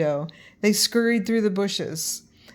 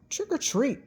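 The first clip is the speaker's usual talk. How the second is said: doctor voice; 8 semitones higher than usual. A narrator's reading. Doctor greets the kids.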